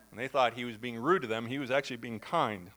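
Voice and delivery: ordinary delivery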